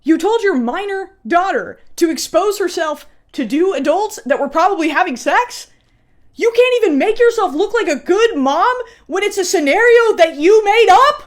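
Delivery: exasperated rant. Real, not acted. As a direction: build to a loud disbelieving shout. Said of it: "outraged commentary".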